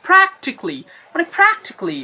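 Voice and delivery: প্র্যাকটিক্যালি as three-syllable "PRAK-tik-li"; no "ka" sound in প্র্যাকটিক্যালি; real pronunciation. Shown as a demonstration. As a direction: punch the start of প্র্যাকটিক্যালি, end in "-kli". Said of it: "real উচ্চারণটা হচ্ছে".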